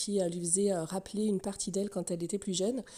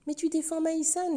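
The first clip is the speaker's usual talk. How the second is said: higher-pitched mock objection; mock objector; 7 semitones higher than usual. Imagined viewer challenges the host on taking sides, mock objection.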